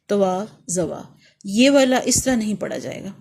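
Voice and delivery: ordinary delivery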